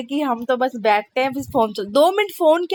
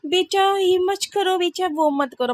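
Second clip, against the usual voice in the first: put-on high, slow and sugary, coaxing the child gently; the patient mother she is not, drawn out sweetly; patient sweet mom; 7 semitones higher than usual. Mother imitating the patient way of scolding a child, sweet and slow. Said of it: "हमारे में patience वाले नहीं है भैया".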